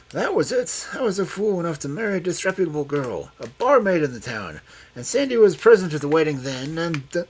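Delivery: put-on high, loud, agitated old man; agitated confession. A narrator's reading. Old man confessing his marriage to Loveday, agitated.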